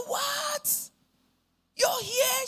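very high, drawn-out shriek of surprise; retold astonished scream. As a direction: imitate dad shrieking in disbelief. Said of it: "My dad screamed".

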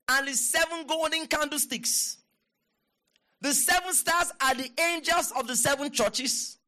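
high, measured proclamation; declaimed scripture reading. Real, not acted. Preacher has everybody read the verse, high declaiming voice.

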